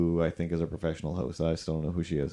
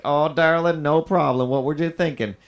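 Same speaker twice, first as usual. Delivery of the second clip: Southern groomer; 8 semitones higher, 4 dB louder than usual. Retold or imitated. Southern groomer reassuring a customer, in a mock Southern accent. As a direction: play the sugary Southern groomer, lightly. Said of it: "except they're Southern".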